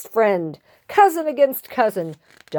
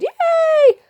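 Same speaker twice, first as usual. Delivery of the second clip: very high squealed cheer; crowd cheer; 4 dB louder than usual. Acted. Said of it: "the crowd cheered".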